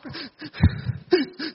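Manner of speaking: high, broken mock sobs with gasps; demonstrated choked weeping. Shown as a demonstration. Monk showing crying over an injustice, choked mock weeping. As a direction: act out wronged, hitching sobs. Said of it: "Đấy, gọi là khóc tức tưởi".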